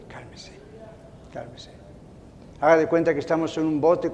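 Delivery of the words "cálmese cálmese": very soft, low murmur; hushed, reassuring word; gentle calming. Real, not acted. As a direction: soothe the listeners softly. Soothing the congregation.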